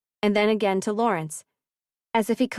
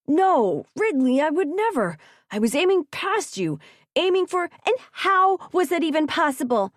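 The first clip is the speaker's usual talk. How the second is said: agitated; 8 semitones higher, 4 dB louder than usual. A narrator's reading. Heated denial and questioning.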